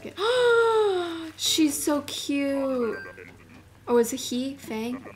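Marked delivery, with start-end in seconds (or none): 0.2-1.3 sharp, high gasp of delight. Real, not acted. Gasping in delight on spotting a cute villager. 1.4-3.0 slow, stretched-out cooing. Real, not acted. Cooing slowly over a cute villager on screen.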